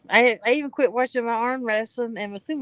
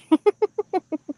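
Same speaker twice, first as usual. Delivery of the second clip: high laugh; 11 semitones higher, 4 dB louder than usual. Real, not acted. Laughing at own impression.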